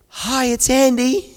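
overly cheery phone greeting; hopeful greeting. Retold or imitated. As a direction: answer the phone hoping for good news. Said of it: "hoping it's gonna be good news".

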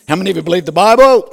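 "How many of you believe the Bible?" shouted fast and high. Shown as a demonstration. Preacher demanding a bigger answer from congregation, shouting.

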